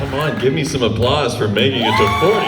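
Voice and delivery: loud, pumped-up call to the crowd; rally the room for a cheer; calling out for applause. Real, not acted. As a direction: shout it out to the audience.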